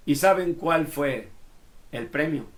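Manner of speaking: ordinary talk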